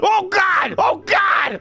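panicked yell. Real, not acted. About to vomit in game.

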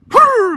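high, loud lip-flapping horse snort. Retold or imitated. Horse answering dad with a loud high lip-flap snort. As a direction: blow a raspberry like an annoyed horse. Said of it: "he just kinda went".

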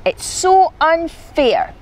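high, drawn-out protest; whiny complaint.

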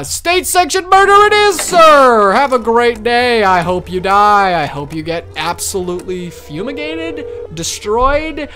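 high, loud triumphant taunt; gloating shout. Pretend. Inspector gloats at the doppelganger he just condemned, loud shout. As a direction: bellow the sentence like a victory cry.

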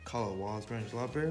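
ordinary talk, nothing marked

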